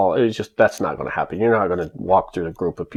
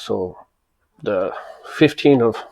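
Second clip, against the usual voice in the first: ordinary talk, nothing marked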